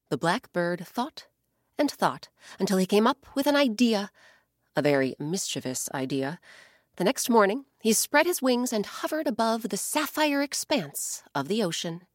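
plain reading voice

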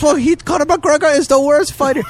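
high-pitched put-on caller voice; high, mock-argumentative caricature; imitated scam caller. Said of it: "He goes".

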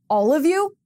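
can't believe the whole group is conservative; surprised. Retold or imitated.

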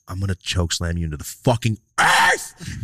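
growing menace, drawn out slowly; menacing threat. Retold or imitated. Man retelling his threat to choke slam someone, menacing. Retold threat building to losing temper.

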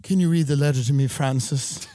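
rich American putting on British airs; affected British accent. Acted. Rich Malibu resident to Francis, affected British accent. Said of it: "I talk like this".

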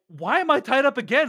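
mock kid's complaint. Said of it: "one of the kids is like".

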